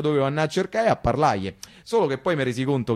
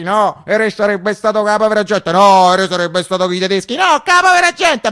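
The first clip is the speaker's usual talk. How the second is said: louder, higher heated argument; quarrelling voices; 5 semitones higher, 8 dB louder than usual. Retold or imitated. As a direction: shout it over the crowd like a quarrel. Arguing crowd voices retold.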